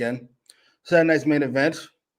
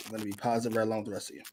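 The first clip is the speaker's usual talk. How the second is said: usual speaking voice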